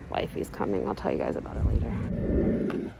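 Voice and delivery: low, near-whispered aside; hushed aside. Real, not acted. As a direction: drop to a murmur, almost no voice. Aside as someone approaches.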